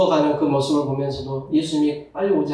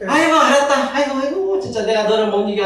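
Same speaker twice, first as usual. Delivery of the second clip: giving-in parent; 7 semitones higher than usual. Retold or imitated. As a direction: throw up your hands at the stubborn child.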